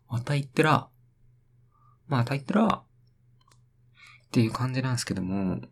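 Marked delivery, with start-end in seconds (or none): 0.1-2.8 a hint of a teasing onlooker, higher; mocking onlooker. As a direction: jeer at yourself like a bystander. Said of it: "うわ、また言ってる". (からかう他人の声で軽く)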